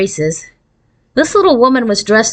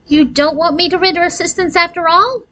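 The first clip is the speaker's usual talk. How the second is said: crushed; 10 semitones higher than usual. A narrator's reading. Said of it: "Aurora looked crushed".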